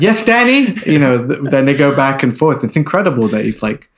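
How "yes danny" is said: high-pitched imitation of the actor's switch; switched character voice. Showing the actor going back and forth, high mimicked voice. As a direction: jump up into the put-on film voice. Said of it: "when he's going between the two".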